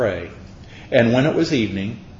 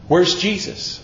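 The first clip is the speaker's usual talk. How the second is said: Where's Jesus? asked higher and more urgently, rising on Where's; higher searching question; 6 semitones higher than usual. Shown as a demonstration. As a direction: repeat "Where's Jesus?" pitched up, the anxiety growing.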